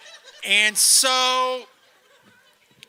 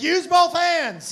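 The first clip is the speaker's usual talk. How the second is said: slow, louder mock shout; 7 semitones higher, 5 dB louder, much slower than usual. Comic taunting his tour mate, slow mock shout. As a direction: goad him on, drawn out. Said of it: "how badly I wanted to be like".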